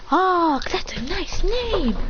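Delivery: high, slow admiring coo; friendly compliment. Acted. Praises the new boy's name.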